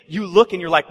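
normal talking voice

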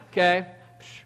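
ordinary talk, nothing marked

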